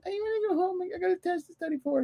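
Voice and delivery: put-on high, small child's whine; squeaky little-kid complaint; whining kids. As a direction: mimic pestering kids, high and soft. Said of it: "the kids kept going".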